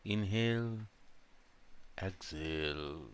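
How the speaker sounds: slow, drawn-out meditation voice with long pauses; guided meditation. Real, not acted. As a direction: guide them calmly through each breath, unhurried. Leads a breathing meditation. (धीमी, शांत ध्यान कराने वाली आवाज़)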